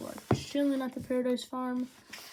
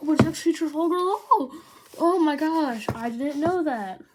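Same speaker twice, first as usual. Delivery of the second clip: sarcastic surprise; 6 semitones higher, 11 dB louder than usual. Pretend. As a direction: gush over it loudly without meaning a word. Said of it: "I'm being sarcastic".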